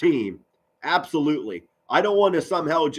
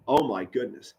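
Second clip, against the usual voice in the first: ordinary delivery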